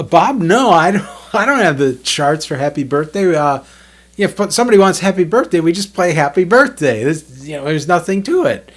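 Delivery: amused disbelief. Retold or imitated. Jim laughing at Bob, retold.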